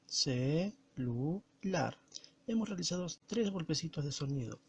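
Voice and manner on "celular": "celular" broken into ce, lu, lar with clear pauses; syllable by syllable. Shown as a demonstration. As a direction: say "celular" very slowly, one beat per syllable. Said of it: "Vamos a pronunciar".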